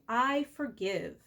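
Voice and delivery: slow, drawn-out I forgive; slow on the out-breath. Shown as a demonstration. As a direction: let it out slowly with the breath. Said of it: "breathe out and say".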